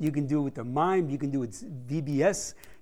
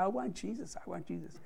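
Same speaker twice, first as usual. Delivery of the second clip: soft, fading, tearful pleading; quiet imitated weeping old man; tearful plea; 3 semitones higher, 12 dB quieter than usual. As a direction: hush it out as if about to cry. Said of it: "he started crying".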